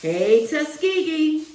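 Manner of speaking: put-on rally the kids with the school's name; school cheer. Teacher cheering the school to her class, slow rallying call. Alumni greeting on the Ave.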